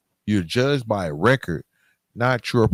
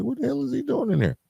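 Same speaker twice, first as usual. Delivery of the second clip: high, fast mock guard voice; 3 dB quieter, faster than usual. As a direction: play the baffled guard, pitched way up and fast. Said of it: "it was like".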